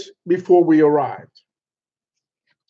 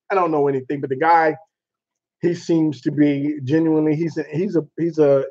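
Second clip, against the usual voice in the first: normal talking voice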